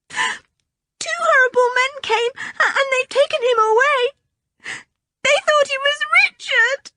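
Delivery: a little girl sobbing out bad news; wailing. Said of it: "Anne's voice became a wail".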